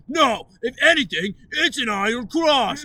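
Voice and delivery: loud, high offended protest; indignant retort. Retold or imitated. Mocking the sweater guys' offended protest, loud. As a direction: huff back at the insult. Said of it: "And they go".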